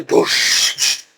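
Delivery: soft breathy boosh sound; little whispered explosion noise; imitated blast. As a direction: mimic a distant explosion under the breath.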